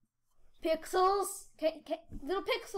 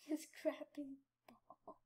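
laughing whisper; 9 dB quieter, slower than usual. Real, not acted. Giggling at another snake.

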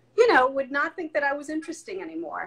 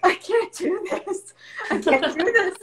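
high, loud, half-laughing wail, slowed; laughing mock despair; mock breakdown; 8 semitones higher, 5 dB louder than usual. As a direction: ham up a frazzled mom cracking. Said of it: "And I'm like".

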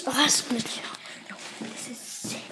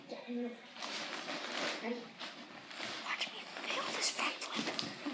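whispering; 4 semitones lower, 16 dB quieter than usual. Real, not acted. About to flip.

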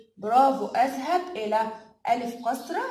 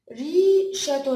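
very slow, louder "ريشة", syllables stretched apart; sounded out; 4 dB louder, much slower than usual. Shown as a demonstration. Teacher repeating the word slowly for children to split it. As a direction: draw the word out for the kids. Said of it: "ايه اللي هيروح الأول".

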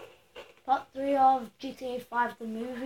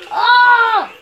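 a sudden, much louder and higher Oh; shocked shout; 9 semitones higher, 16 dB louder than usual. Real, not acted. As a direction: yell it as the car wrecks. Crash in the game.

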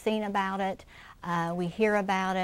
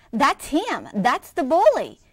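louder, higher frightened exclamation; alarmed cry; 5 semitones higher, 9 dB louder, slower than usual. Acted. Scared cub points out bully.

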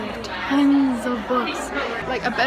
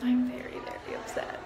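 whispered in a quiet store; breathy whisper, keep it down; whispered mock upset; hushed; 10 dB quieter than usual. Real, not acted. Whispering in the bookstore.

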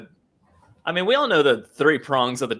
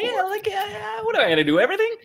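whiny mock complaint. Mock whiny reply to boss.

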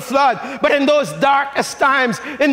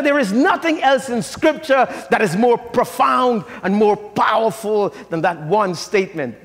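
ordinary talk

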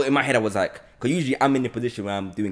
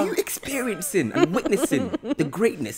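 mock grandiose; 10 semitones higher than usual. Host mock-boasts about his hosting.

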